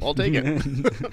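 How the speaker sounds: drawn-out, eager "I'll take it"; slow eager agreement. Retold or imitated. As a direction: savour each word, keen. Ordering coffee, eager acceptance.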